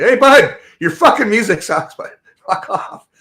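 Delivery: loud, high shout across the street; yelling at a stranger. Retold or imitated. Man yelling at a guy's loud music at the bus stop, loud call-out. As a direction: call him out loudly from across the road. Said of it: "So I had to fucking tell him".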